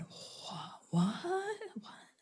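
whispered gasps of disbelief, room murmuring what; shocked whisper. Retold or imitated. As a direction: breathe it like a stunned crowd. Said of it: "the whole room went".

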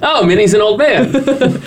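high laughing punchline. Real, not acted. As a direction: giggle through the joke. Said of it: "cracked joke".

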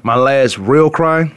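real stressed, said slowly; slow down and lean on real. Shown as a demonstration. Telling of his mother's death, slow with stress on real. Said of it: "not last time I cried".

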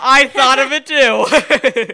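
excited laughing exclamation. Real, not acted. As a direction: burst out with glee. Excited shared memory.

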